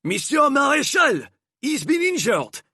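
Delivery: very high, loud alarmed cry; calling out in alarm. A narrator's reading. Enrique calls out in alarm, very high and loud. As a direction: cry out as if panicked.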